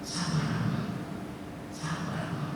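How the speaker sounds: soft, hushed voice of divine reassurance; gentle comfort. Acted. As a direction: soothe the servant quietly. Voicing God's comfort. (suara lembut menenangkan)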